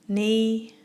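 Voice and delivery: ordinary talk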